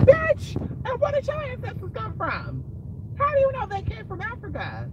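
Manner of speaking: high, loud imagined retort; incredulous challenge. Retold or imitated. Thinking to herself as she hears the women argue, high incredulous outburst.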